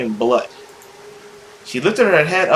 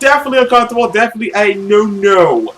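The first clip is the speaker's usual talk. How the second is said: loud, fast, higher flustered; flustered reaction; 5 semitones higher, 5 dB louder than usual. Real, not acted. Streamer reacting to the uncomfortable scene, fast and flustered. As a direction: rush it out uneasily.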